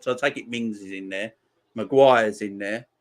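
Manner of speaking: normal talking voice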